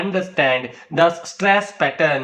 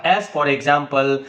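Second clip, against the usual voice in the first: ordinary delivery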